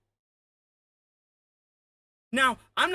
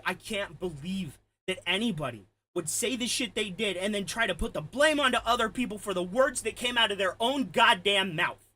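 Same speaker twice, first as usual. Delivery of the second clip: usual speaking voice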